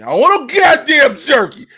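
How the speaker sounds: put-on high, loud childish refusal; petulant shout. Comedian refusing jerky from friends, petulant shout. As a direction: yell it like a tantrum.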